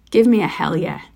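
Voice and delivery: ordinary talk, nothing marked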